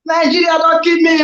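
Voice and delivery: high, shouted plea; hammed-up despairing commentator shout; mock anguished shout. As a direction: yell the despair at the team. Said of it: "Do am in the pidgin lifestyle".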